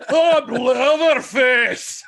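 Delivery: slow, high, loud Scottish holler; Scottish accent. Acted. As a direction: drag it out in a hammed-up Scottish roar. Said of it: "No, you went Scottish".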